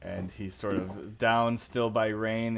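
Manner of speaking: ordinary talk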